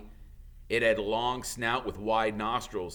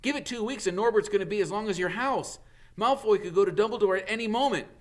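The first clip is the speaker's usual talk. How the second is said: higher, fast urgent warning; loudly; 11 semitones higher, 5 dB louder, faster than usual. Acted. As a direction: press him hard, talking fast. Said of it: "said Harry loudly".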